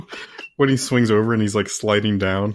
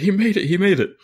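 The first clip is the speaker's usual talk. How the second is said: higher-pitched, excited quote, breaking into laughter; playful mock excitement; excited Luther; 6 semitones higher than usual. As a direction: imitate a thrilled teammate cheering a success. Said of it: "Luther so excited".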